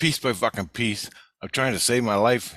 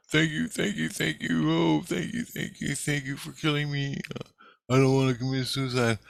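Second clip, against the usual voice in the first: high pleading thank-you voice; mock grateful spirit, voice raised high; switched to grateful spirit voice; 7 semitones higher than usual. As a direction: play the ghost begging, pitched up. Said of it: "And then he switches to".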